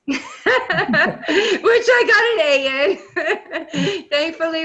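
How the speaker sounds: laughing right through the words. Real, not acted. Laughing to the panel about her A in statistics. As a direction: giggle it out, pitch jumping up.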